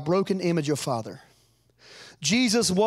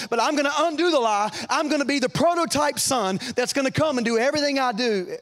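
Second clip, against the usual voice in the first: preaching at full force; 5 semitones higher than usual. Acted. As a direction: lift them up with each line. Preaching climax.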